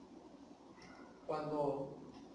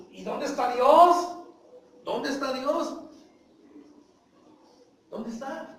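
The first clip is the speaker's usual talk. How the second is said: a loud, high, slow plea of where is God; doubting Israelites asking where God is; complaining crowd; 6 semitones higher, 8 dB louder, slower than usual. Acted. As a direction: voice the doubting people, higher. People of Israel demand God.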